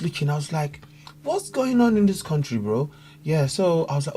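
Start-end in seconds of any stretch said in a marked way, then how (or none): 1.2-2.9 high-pitched acted bewilderment, dropping on the last word; baffled newcomer. Newcomer from Africa puzzled by the country, baffled. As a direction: replay your bafflement, pitched right up. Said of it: "I was so confused".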